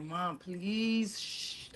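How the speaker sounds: soft, pleading shush; retold hushed begging; hushed plea. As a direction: beg mom to keep it hush, trailing into a shh. Retold plea to keep secret.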